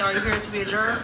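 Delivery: normal talking voice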